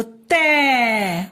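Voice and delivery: तै held long, gliding down; drawn-out syllable. Shown as a demonstration. Teacher pulling the sound out of the word, drawn-out तै. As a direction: isolate and stretch तै. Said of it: "बोलते हैं ना बेटा".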